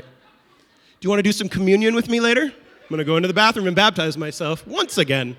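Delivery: loud, beaming churchy blessing; mock super-spiritual blessing. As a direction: overdo the holy talk to drive them off. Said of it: "super spiritual with them".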